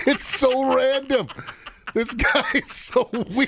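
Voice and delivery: high, slow, laughing through words. Real, not acted. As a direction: giggle while you talk. Laughing at the story.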